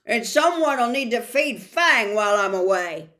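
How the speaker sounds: loud, pointed announcement; loudly. Acted. Hagrid says loudly who should feed Fang. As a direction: make sure the hidden boys hear. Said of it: "said loudly".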